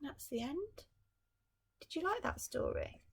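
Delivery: usual speaking voice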